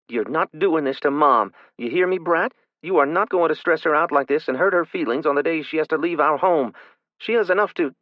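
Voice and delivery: high, loud protective scolding; angry scolding. A narrator's reading. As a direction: scold her for hurting Mom. Big sister scolding struggling child.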